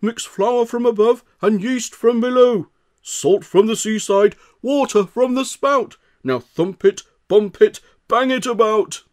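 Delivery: high, loud bossy baker's instructions; baker giving orders. A narrator's reading. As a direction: command the crowd like a busy master baker. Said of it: "Bap gave instructions".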